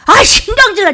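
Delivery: high, loud burst of annoyance; irritated griping. Acted. Grumbler passing as a penitent, irritated griping. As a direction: let exasperation burst out. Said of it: "푸념을 얘기하는 것이에요".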